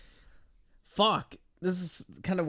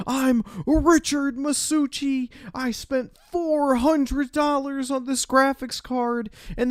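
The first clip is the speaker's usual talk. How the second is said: much higher, louder squealing rant, slowed on the boasts; mocking entitled Rich; 12 semitones higher, 6 dB louder than usual. Host mocks Rich's rant at the store clerk, in a high, loud, squealing parody voice. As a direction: ham up a self-important customer demanding special treatment.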